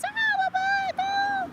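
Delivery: hammed-up high squeal at a bug; mock girly shriek. Mocking girls scared of bugs, high hammed-up shriek. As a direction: play the squeamish girl she is not, shrieking. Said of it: "I'm not one of those".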